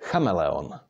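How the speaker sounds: "Chameleon" as KHA-me-le-on, with throaty CH; Czech first-syllable stress. Shown as a demonstration. Teacher reads the word in Czech, "Chameleon" stressed on CHA. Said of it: "put the stress on the first syllable".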